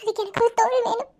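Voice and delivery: sobbing between broken phrases; crying. Acted. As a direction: break down in tears, voice climbing as you apologise. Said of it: "இப்ப நான் அழணும்". (தேம்பி அழுதபடி)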